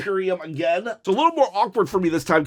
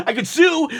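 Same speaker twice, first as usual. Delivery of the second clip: laughing; 4 semitones higher than usual. Real, not acted. Joking about a refund.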